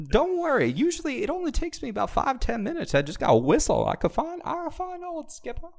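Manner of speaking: put-on southern accent. GM playing lost-horse Paul Revere, hammy southern accent. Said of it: "What accent is this?".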